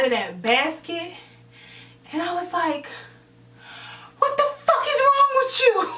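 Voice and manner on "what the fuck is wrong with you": very high, loud acted outburst; outraged shriek. Girl out of the basket confronting her pusher, shrieking outrage. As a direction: scream the question in retold outrage.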